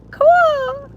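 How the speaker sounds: high squeaked cool; high awkward reply; squeaky cool. Retold or imitated. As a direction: squeak out one awkward word. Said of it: "I would be like".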